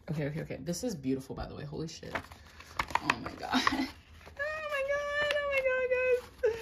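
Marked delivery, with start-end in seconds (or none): 4.4-6.6 much higher, slow squeal of joy. Real, not acted. Fan squeals at her card pull, overjoyed. Pulling favourite photo card.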